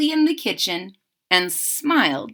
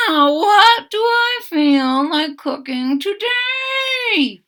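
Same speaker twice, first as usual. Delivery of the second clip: high, slow musing to himself; a lightly voiced baker pondering; high slow wondering; 10 semitones higher, slower than usual. A narrator's reading. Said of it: "he said to himself".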